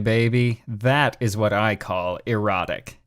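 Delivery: ordinary talk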